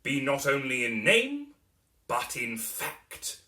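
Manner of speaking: loud, slow, stately proclamation; proud father naming his heir; pompous declaration. A narrator's reading. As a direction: announce the family firm like a decree. Dombey declaring the firm's name.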